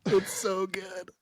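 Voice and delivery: high, slow, through laughter; worn out from laughing, pitch up. Real, not acted. Delighted at a friend's accent, laughing.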